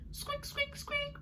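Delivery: tiny mouse squeak for fun; squeaky Artie, high and soft. Shown as a demonstration. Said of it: "Artie's voice is a squeaky voice".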